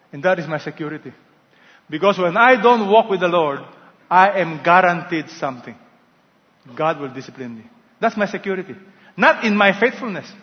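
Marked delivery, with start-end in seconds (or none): none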